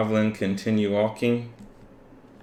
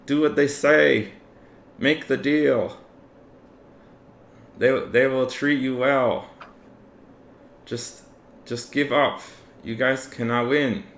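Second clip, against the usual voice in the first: higher-pitched pleading dwarf voice; captive dwarf; 5 semitones higher than usual. Acted. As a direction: urge the captors in a raised character voice. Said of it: "The dwarf, ... looks to the goblin ... and says".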